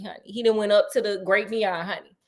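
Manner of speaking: ordinary delivery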